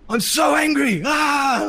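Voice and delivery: high, loud furious shout; angry outburst. Retold or imitated. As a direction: burst out with the anger at last. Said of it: "it was just like".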